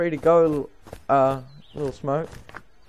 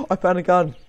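normal talking voice

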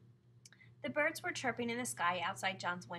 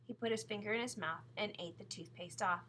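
usual speaking voice

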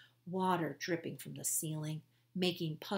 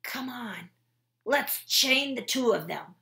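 gruff guard; 6 semitones higher, 8 dB louder than usual. Acted. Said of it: "said Squinty".

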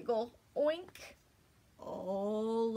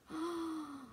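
gasp; 5 semitones higher, 5 dB quieter than usual. Acted. Reading, surprised at Duck's note.

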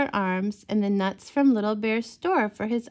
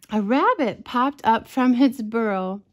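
usual speaking voice

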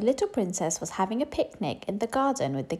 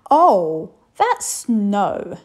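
loud, different-timbred king explaining; a jolly king answering his daughter; booming fatherly king; 9 dB louder, much slower than usual. A narrator's reading. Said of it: "said the king".

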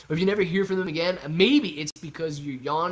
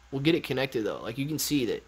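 normal talking voice

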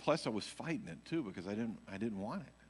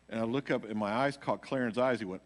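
ordinary talk, nothing marked

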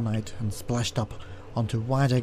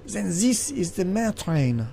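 slow, higher-pitched exclamation; 7 semitones higher, slower than usual. A narrator's reading. Valet realising which train he boarded, slow exclamation. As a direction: exclaim it slowly, with rising pitch. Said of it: "I exclaimed".

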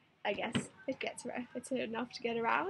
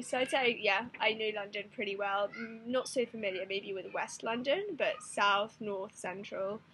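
normal talking voice

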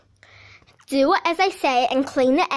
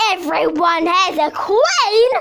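loud insistence; 3 semitones higher, 7 dB louder, slower than usual. Acted. Insisting to the new girl.

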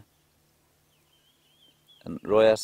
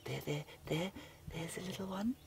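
higher, hushed coo of delight; soft delighted coo; 12 semitones higher, 8 dB quieter than usual. Real, not acted. Guide softly spotting the cub again for viewers, tender coo. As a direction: point it out tenderly under your breath.

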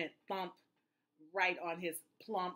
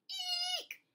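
very high-pitched eek; frightened squeal. A narrator's reading. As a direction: squeal like you've seen a mouse. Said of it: "shouted the elephant".

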